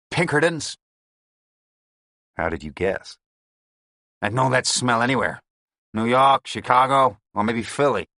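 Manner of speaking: lightly American, mocking the agent; New York accent. A narrator's reading. Gang boss taunting the Pinkerton agent, mocking New York accent. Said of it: "It was his accent I had heard".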